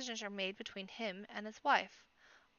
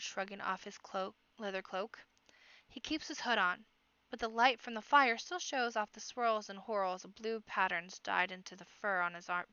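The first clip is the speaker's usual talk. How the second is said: ordinary delivery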